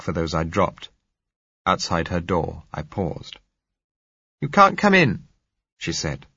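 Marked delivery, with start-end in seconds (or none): 4.4-5.3 put-on raised, lighter young woman's voice. A narrator's reading. Young woman claiming the bedroom, light and high. Josella calling from her room.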